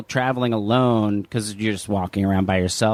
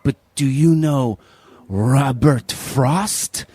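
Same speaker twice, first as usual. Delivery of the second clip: slow, halting retold question; careful imitation of a halting foreign speaker; imitating broken English; much slower than usual. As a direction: draw out the name slowly, word by word. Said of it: "very broken English".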